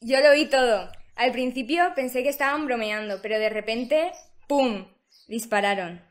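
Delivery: loud, agitated eyewitness; shocked witness. Acted. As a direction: blurt out what you saw.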